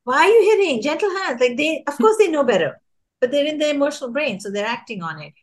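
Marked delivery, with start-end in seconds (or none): 0.0-1.4 high-pitched scolding grown-up, much higher; mock scolding adult. Teacher scolding a child in a high mock voice. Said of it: "Or you use sentences like".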